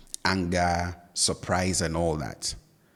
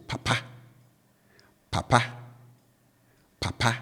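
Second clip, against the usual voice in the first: "papa" low first syllable, raised last syllable; low-high tone; 4 semitones higher, faster than usual. Shown as a demonstration. Teacher showing learners the third Twi word, low-to-high tone on "papa". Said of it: "I raise it".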